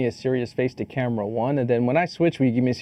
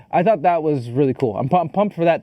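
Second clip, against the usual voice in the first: usual speaking voice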